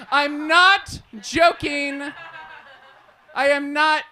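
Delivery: ordinary delivery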